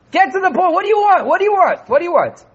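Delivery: high, loud, impatient demand. Acted. Impatient listener cutting off a long story, high and loud. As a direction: snap it out, fed up with the long list. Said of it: "when you can afford to be impatient".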